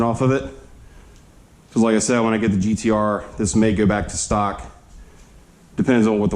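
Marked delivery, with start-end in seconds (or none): none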